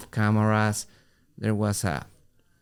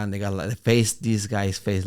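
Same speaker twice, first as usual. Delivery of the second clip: ordinary delivery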